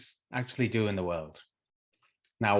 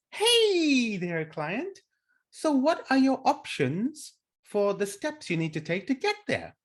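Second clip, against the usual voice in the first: mock upbeat coach, much higher; sing-song the coach's next question; chirpy coach voice; 11 semitones higher than usual. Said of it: "And then the coach goes".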